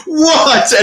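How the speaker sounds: two stunned, high whats; disbelieving what. Retold or imitated. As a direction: squeak it out in disbelief. Retold disbelief at eater.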